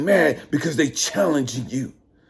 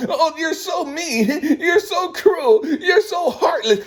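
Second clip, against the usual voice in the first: high, slow mocking whimper with a laugh; mock whine; 9 semitones higher, slower than usual. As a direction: whine it back at them like a crybaby, laughing. Mocking a whiny victim.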